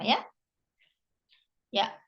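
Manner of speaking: ordinary talk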